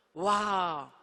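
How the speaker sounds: loud wondering wow; awed wow. Acted. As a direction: breathe out in wonder. Friend's awe at talking with God.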